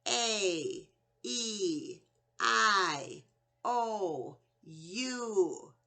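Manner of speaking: letter names, spaced apart; long A, E, I, O, U said as names; long vowel names. Shown as a demonstration. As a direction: say each vowel by its name. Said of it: "the long vowel sounds say their name".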